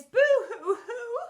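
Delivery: high, loud, slow hammed-up boo-hoo; mock sobbing. Teachers bursting into tears of joy, hammed-up sobbing. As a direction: blubber it comically. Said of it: "burst into floods of tears".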